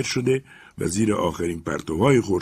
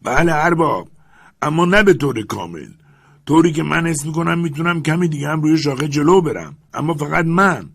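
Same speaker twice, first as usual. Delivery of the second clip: put-on high, louder old servant's reply from above; old servant calling down to his master, high and loud; 8 semitones higher, 7 dB louder than usual. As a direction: answer the master dutifully from up the tree. Jupiter answers from above.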